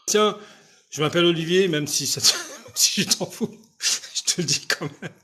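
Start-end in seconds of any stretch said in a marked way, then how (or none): 2.5-5.1 laughing aside. Real, not acted. Laughing aside about his name.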